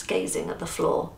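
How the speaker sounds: usual speaking voice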